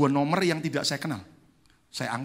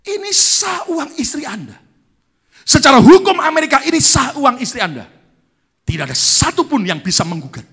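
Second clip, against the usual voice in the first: high, loud and firm ruling; emphatic lawyer; 11 semitones higher, 14 dB louder than usual. Retold or imitated. Church lawyer telling the pastor the money is legal, loud and firm. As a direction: lay down the law, booming.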